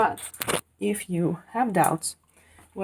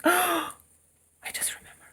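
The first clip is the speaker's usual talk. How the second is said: sudden gasp; 11 semitones higher than usual. Real, not acted. Suddenly recalls forgotten flaxseed.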